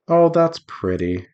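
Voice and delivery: ordinary talk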